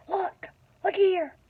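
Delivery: a light, high, pressed stage whisper, half-voiced; fierce whisper. Shown as a demonstration. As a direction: hiss it urgently to a friend. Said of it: "Can you whisper fiercely?".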